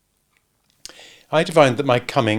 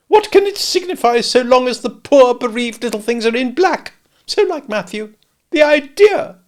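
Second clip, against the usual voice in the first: high, loud fussy lady's lament; Camilla complaining; 12 semitones higher, 6 dB louder than usual. A narrator's reading. Camilla grumbles to relatives about Matthew, high and loud. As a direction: whine on in high pitch about her husband.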